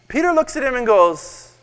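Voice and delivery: ordinary talk, nothing marked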